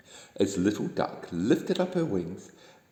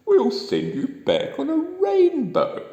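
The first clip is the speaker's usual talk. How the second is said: much higher, slow put-on voice for the Man in the Moon; excited high voice for the moon man; 7 dB louder, slower than usual. A narrator's reading. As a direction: exclaim it slowly in a high storybook voice. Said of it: "exclaimed the Man in the Moon".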